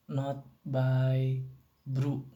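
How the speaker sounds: normal talking voice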